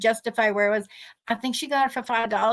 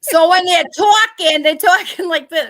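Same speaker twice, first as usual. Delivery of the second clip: mock Massachusetts accent; 5 semitones higher, 10 dB louder than usual. Imitating Massachusetts band talk.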